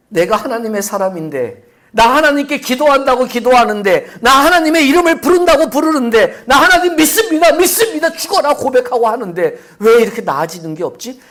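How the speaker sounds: loud, high anguished cry; desperate believer. Acted. Believer crying out, doubting God, loud and high. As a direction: plead out the doubts at full voice.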